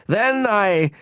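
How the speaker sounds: high, slow, halting royal command that loses its thread; king fumbles while commanding a yawn; sputtering, annoyed. Acted. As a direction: stumble over the order, a touch annoyed. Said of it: "he was sputtering a little and seemed annoyed".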